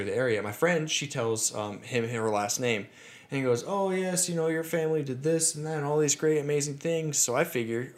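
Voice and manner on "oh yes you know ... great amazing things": higher-pitched, enthusiastic retold driver; impressed cab driver. Cabbie telling a passenger her family's great history, impressed. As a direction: voice an eager local historian, pitched up.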